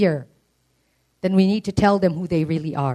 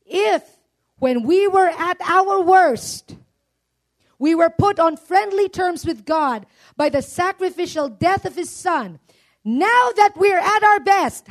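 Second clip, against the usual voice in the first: higher, slow, fervent reading; preached scripture reading; 11 semitones higher, slower than usual. Acted. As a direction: lift the verse up slowly like a proclamation. Reads scripture with fervour.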